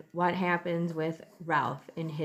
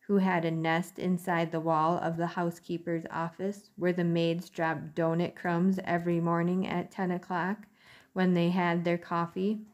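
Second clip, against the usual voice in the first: ordinary delivery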